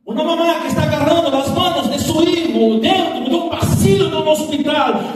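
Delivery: loud, ringing sermon delivery with rising intensity; full-force preaching. Acted. Pastor preaching to the church, loud and fervent. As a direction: preach it out to the congregation.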